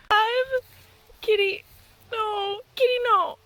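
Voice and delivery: high, loud dismay at the cat; real distress. Real, not acted. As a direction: cry out to stop the cat. Pleading with cat over its prey.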